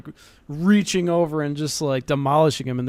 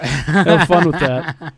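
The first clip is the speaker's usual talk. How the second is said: laughing sarcastically; 6 dB louder, much slower than usual. Real, not acted. Sarcastic aside while laughing.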